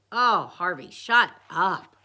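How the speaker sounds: loud, irritated retort; exasperated. A narrator's reading. Kid fed up with Harvey's puppet, loud retort. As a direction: shut him down loudly.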